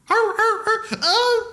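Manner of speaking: high-pitched mock yelping; imitated dog yelps. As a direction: yelp like a dog feigning injury. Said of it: "you just hear".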